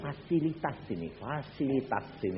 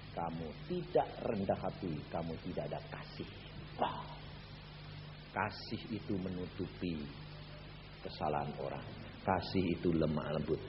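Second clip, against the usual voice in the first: lower, softer, measured; gentle voice of God; 8 semitones lower, 10 dB quieter than usual. Retold or imitated. God gently corrects the preacher, low and soft. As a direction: speak calmly with authority. God's voice explaining love.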